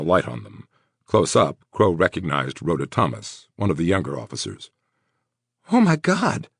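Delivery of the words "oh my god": high, shocked gasp of the words. Acted. Officer seeing the injured woman, shocked gasp. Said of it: "Rhoda gasped".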